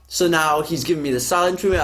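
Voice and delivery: ordinary talk, nothing marked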